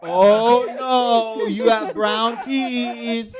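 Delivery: loud, hammed-up dentist gasping at brown teeth; mock alarmed dentist. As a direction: play the dentist over-the-top worried. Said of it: "And they're like".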